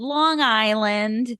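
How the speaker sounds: slow, stretched Long Island vowels; Long Island accent. Retold or imitated. Kid answers casting director, broad Long Island accent. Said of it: "speech to lose that accent".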